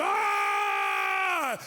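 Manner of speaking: loud, low, raw banshee yell at the bear; dad yelling "Ah!" to chase a mama bear; banshee scream. Retold or imitated. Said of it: "I sound like a banshee".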